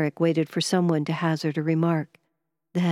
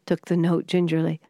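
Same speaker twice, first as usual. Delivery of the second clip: ordinary delivery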